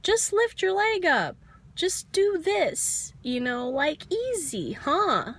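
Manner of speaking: high, bright mock coaxing of a yoga classmate; imitating Brenda. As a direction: chirp it like a cheery gym buddy egging you on. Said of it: "and she's like".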